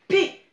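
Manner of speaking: "P" said pé, high and very loud; syllable dictation. Shown as a demonstration. Teacher giving the last syllable, loud high burst. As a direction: punch out "P" as one short pé. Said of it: "la dernière syllabe".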